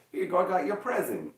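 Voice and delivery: high, sing-out present delivery; playful gift-giving. Pretend. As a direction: act out handing over a little surprise. Acts out handing over a gift.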